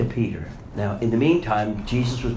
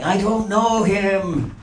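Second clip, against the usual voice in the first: slow, high, loud I don't know him; vehement denial; 8 semitones higher, 4 dB louder, much slower than usual. Retold or imitated. Peter vehemently denying Jesus, slow and loud. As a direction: spit out each word. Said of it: "vehemently denying".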